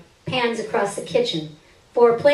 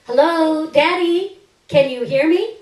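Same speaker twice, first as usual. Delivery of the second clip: louder, higher call with rising ends; 6 semitones higher, 6 dB louder than usual. Acted. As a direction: call into the empty house for Daddy. Calling out to absent father.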